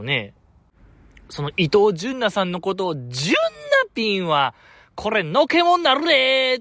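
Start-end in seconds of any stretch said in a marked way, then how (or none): 4.9-6.6 strong Kansai dialect. Retold or imitated. Warning a fan about name-calling, in strong Kansai dialect. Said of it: "僕結構強めの関西弁で".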